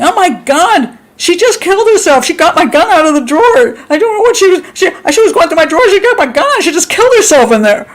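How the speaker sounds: high-pitched acted panic; frantic, high, wailing mock panic; freaking out. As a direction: play hysterically reporting a shooting. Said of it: "you're freaking out".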